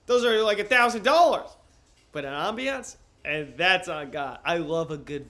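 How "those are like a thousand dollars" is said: loud, high-pitched protest; raised voice, indignant about the price; mock outrage. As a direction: exclaim it in loud disbelief. Mock outrage at ambulance cost.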